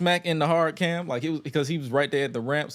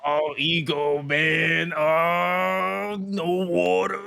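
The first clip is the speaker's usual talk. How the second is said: higher, drawn-out dramatic lament; wrestler impression; 5 semitones higher, much slower than usual. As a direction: cry it out like a tortured heel. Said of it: "He gonna be like".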